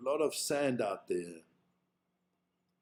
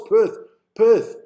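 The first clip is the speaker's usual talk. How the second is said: loud excited call; 8 semitones higher, 13 dB louder than usual. Real, not acted. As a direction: shout the city name, much louder. Excited yelling of city name.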